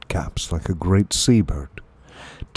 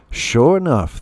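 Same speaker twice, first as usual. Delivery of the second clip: ordinary delivery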